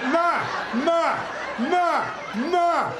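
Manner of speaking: put-on high, loud, whining toddler calling for mom; toddler with stuck tongue. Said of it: "I'd be like".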